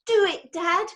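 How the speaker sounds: a son egging his dad on, bright and pushy; eager boy. A narrator's reading. Son urging father out the window, eager high call. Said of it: "said Jonathan".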